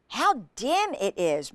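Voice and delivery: ordinary delivery, nothing marked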